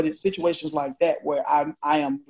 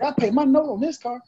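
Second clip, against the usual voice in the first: much higher, put-out indignant voice; retold protest about his own car, higher and indignant; offended protest; 9 semitones higher than usual. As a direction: insist on it like you've been insulted. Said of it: "I was like".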